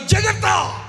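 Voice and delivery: high, loud cry of warning; shouted warning. Real, not acted. Preacher warning serial-watching mothers about their sons, shouted warning. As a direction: cry it out as an alarm.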